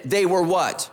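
usual speaking voice

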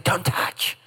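whispered warning, no voice; hushed mock whisper; Mother Nature's warning. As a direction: breathe it out as a hushed caution. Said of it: "Mother Nature is saying".

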